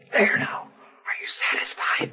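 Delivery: slow hushed whisper. Retold or imitated. Handing over the watch in a chapel, whispering. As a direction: whisper it with mock exasperation. Friend whispering in funeral pew.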